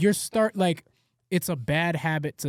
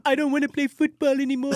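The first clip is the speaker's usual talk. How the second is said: put-on whiny kid voice; 10 semitones higher, 4 dB louder than usual. Dad voicing whiny son.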